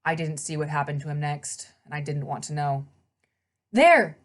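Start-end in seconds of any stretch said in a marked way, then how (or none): 3.7-4.2 calling out. Acted. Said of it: "Annabeth called".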